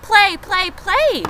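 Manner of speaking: put-on high, loud eager baby goose; excited gosling. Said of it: "cried the excited baby goose".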